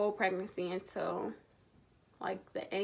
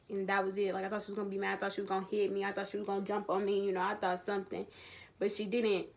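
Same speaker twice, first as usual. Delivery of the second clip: ordinary delivery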